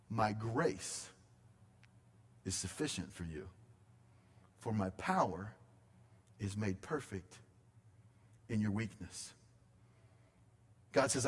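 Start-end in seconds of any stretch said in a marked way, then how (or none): 0.1-9.3 tender scripture reading. Acted. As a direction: let God's promise fall softly. Reads God's words in verse nine.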